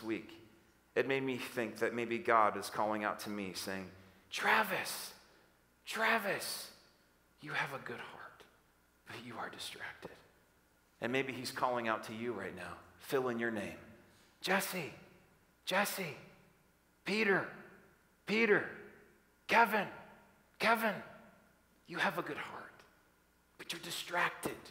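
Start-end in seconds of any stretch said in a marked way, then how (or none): 4.3-10.2 hushed, tender calling that sinks to a near whisper; gentle divine call. Acted. Voices God calling him gently. 14.4-24.6 soft name-calling with falling tones; quiet, caring appeal; gentle divine call. Acted. Voices God calling listeners gently.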